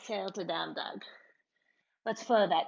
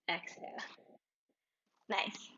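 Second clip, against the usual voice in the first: soft whisper; 8 dB quieter than usual. Real, not acted. Soft cue during held pose.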